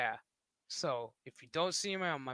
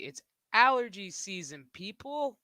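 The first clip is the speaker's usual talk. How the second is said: exasperated call; 4 semitones higher, 8 dB louder than usual. Real, not acted.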